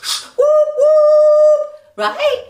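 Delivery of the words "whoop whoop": very high, loud sung whoop; train whoop. Retold or imitated. Mocking the shusher as a train, a high loud whoop. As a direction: hoot like a train whistle.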